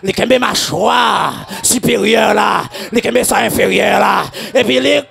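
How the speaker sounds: shouted, driving sermon cadence; fiery preacher in full flow; full-force preaching. Acted. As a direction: preach it hard to the congregation. Preaching the fight, chanting saisi.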